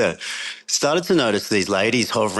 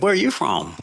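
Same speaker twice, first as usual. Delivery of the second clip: higher-pitched mock American accent; terrible American accent; 9 semitones higher than usual. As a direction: try a bad American accent on the question. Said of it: "Sorry, my American accent is terrible".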